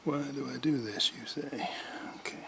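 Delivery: normal talking voice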